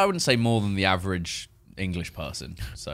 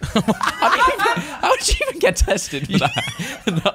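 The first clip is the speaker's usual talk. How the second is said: laughing; slower than usual. Real, not acted. Laughing at friend's joke.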